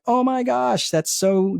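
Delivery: high, slow burst of delight; squealing with happy surprise; overjoyed. Retold or imitated. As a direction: gush like you just won something. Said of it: "it creates your state of joy".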